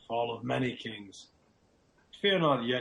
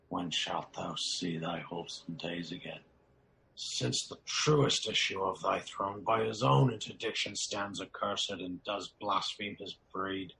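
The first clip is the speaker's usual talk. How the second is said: usual speaking voice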